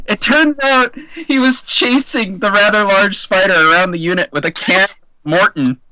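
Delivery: normal talking voice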